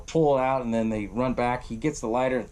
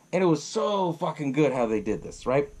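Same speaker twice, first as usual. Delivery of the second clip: ordinary talk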